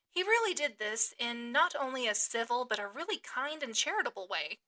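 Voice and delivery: usual speaking voice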